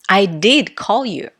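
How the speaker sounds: "did" stressed for emphasis. Shown as a demonstration. Teacher demonstrating emphasis to students, stress on "did". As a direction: punch "did" to insist it happened. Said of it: "how I pronounce these sentences".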